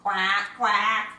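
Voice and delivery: duck quack. Acted. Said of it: "George went".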